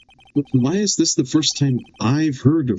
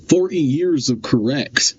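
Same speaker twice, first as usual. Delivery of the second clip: ordinary talk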